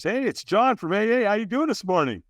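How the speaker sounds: high, bright and cheery; a chirpy early-morning phone greeting; real enthusiastic. Retold or imitated. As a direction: sponsor phoning at eight in the morning, overly upbeat. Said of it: "Real enthusiastic".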